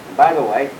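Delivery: ordinary delivery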